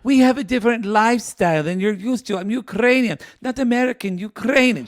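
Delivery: high, insistent mock Ukrainian accent; [name]. Co-host imitating a date explaining her lifestyle, high Ukrainian accent. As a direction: hammer the point in a high Ukrainian voice. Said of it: "And she's like".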